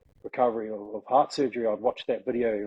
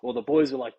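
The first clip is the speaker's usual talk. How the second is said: normal talking voice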